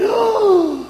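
very high, loud "ah!" of amazement; acted squeal of shock; astonished gasp. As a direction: cry out high at a windfall. Retells shock at the coins' value.

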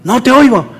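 high-pitched mock complaint. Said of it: "no puede estar uno gritando".

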